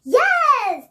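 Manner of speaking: high cackling doll laugh; witch laugh. Shown as a demonstration. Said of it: "laugh gone three times like this".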